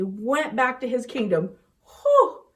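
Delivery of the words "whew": high, loud sigh of relief; big whew. Acted. Teacher tells kids the danger is over, whew of relief.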